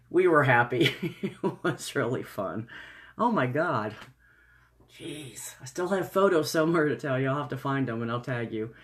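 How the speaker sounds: ordinary talk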